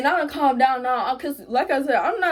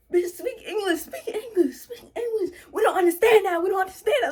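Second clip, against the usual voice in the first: high, pitched-up retold yelling; hammed-up imitation of him shouting at her; mild mock yell, laughing through it; mock screaming friend; 7 semitones higher than usual. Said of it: "screaming out".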